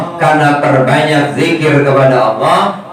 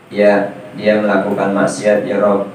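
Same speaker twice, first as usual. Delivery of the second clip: humble witness answer; 5 semitones lower, 4 dB quieter than usual. Acted. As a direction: lower and softer, a body part bowing before God. Hands answering God's question. (suara saksi yang rendah dan pelan)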